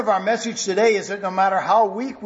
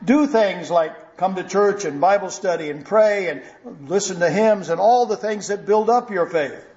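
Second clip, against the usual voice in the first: usual speaking voice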